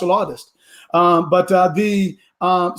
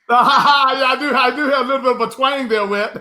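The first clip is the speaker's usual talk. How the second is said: laughing; 3 semitones higher than usual. Real, not acted. Host laughs about his twang.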